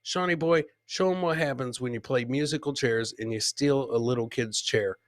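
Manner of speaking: ordinary delivery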